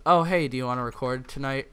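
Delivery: ordinary delivery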